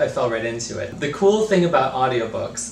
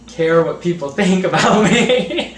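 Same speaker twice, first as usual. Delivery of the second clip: laughing; 6 semitones higher, 8 dB louder than usual. Real, not acted. Laughing while denying she cares.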